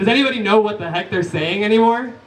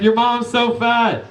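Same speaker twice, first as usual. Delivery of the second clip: high, drawn-out schoolkid taunt; roasting kids; 6 semitones higher, slower than usual. Retold or imitated. Kids roasting the teacher during the eclipse, high slow taunt. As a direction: jeer it slowly in a mock kid voice. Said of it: "They're like".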